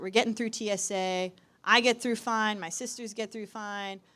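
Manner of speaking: ordinary talk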